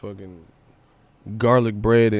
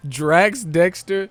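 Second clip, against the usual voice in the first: mock announcing; 7 semitones higher, 4 dB louder, much slower than usual. Mocking a chatter's name.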